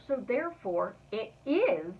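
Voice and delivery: slow, with the word is leaned on hard; stress on is. Shown as a demonstration. Preaching to viewers, slow with is stressed. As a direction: press down on is to make it certain. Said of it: "an emphasis on the word is".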